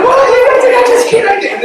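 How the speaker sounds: high, loud mock squeal; hammed-up child backing out in panic; panicking girl. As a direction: screech it slowly, far above normal pitch. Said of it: "the girl's just nervous".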